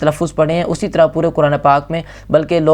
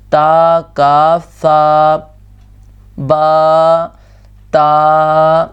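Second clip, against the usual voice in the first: slow recitation; 3 dB louder, much slower than usual. Shown as a demonstration. Teacher drills letter names.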